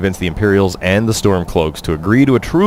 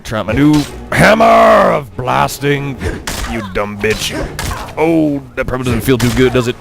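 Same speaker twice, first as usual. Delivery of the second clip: hammy warrior taunt; 6 semitones higher than usual. Pretend. Taunting the bandit in combat.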